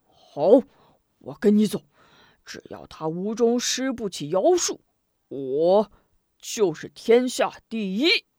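loud, resolute declaration; emboldened. A narrator's reading. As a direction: work up his courage. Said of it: "壮起胆子". (壮着胆子大声说)

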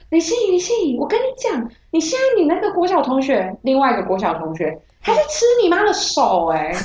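high-pitched, breathless caller blurting out news; high excited caller voice. Retold or imitated. Classmate on the phone telling her the news, high and urgent.

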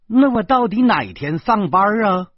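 loud, high, impatient question; glaring demand. A narrator's reading. Job-seeker demanding her start date, loudly insistent. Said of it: "大姐瞪着眼说".

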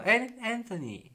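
the name 'Anthony' sung out high and bright; high chirpy Anthony. Retold or imitated. The classmate warmly echoes the name Anthony, chirping it out high. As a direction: chirp the name back at him.

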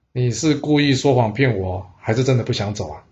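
plain reading voice

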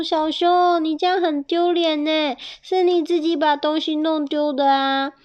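high-pitched mouse voices; chiding little mice. Acted. Mice to crying bear, high chiding voice. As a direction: scold gently in a high little voice.

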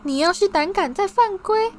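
high, loud mock yell in a put-on voice; bully parodying a mother's scolding; mocking shrill mother. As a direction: screech like a scolding mum. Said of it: "他尖起嗓子，怪腔怪调地吼道".

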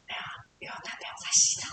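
quick breathy whisper into the phone; secretive whisper to the caller; whispered aside. Retold or imitated. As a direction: whisper it fast so he can't hear. Acts sultry phone voice to caller.

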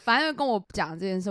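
ordinary talk

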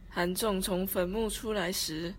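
ordinary talk, nothing marked

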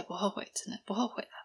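soft whisper to herself; whispered aside. Real, not acted. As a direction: breathe it out as a private whisper. Reassuring herself, no regret. (轻声耳语)

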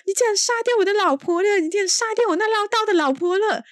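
high-pitched, hammed-up wail; mock the husband's over-the-top grief; grieving old husband. Widowed husband to the heroine, hammed-up high wail. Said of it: "他就讲".

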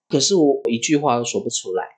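normal talking voice

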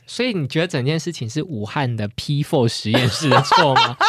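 usual speaking voice

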